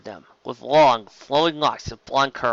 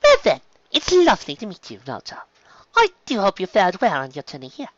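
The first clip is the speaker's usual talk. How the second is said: higher-pitched, courteous ladylike voice; poised lady pony; 7 semitones higher, 4 dB louder than usual. Acted. Pony welcoming the dragon, courteous and high-pitched. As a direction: greet politely in a raised, refined pitch.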